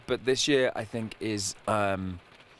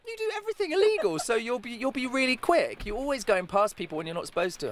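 put-on very high child voice, laughing through it; daughter's voice; 12 semitones higher than usual. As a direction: play the giggling little daughter. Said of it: "And she went".

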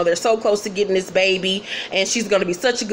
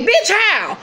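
very high, challenging question; mock incredulous retort; 11 semitones higher than usual. Dezza disputing Ann's accusation, high mock incredulity. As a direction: imitate disbelief with pitch leaping up.

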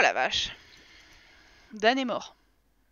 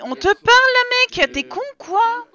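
yelling higher and louder at a sim who won't listen; angry yell; 11 semitones higher, 12 dB louder than usual. Real, not acted. Yelling at a game character.

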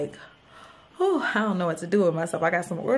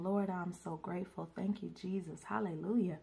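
ordinary talk, nothing marked

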